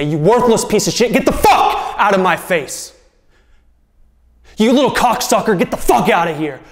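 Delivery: retelling his father's abuse to his son, angry voice; drunk father raging. Retold or imitated. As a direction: spit the insults out like an abusive drunk dad. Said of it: "He'd look at me and say".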